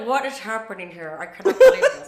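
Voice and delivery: mock-gangster outrage breaking into laughter. Shown as a demonstration. Showing how the family talks, in a laughing gangster voice. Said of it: "talk in that gangster voice".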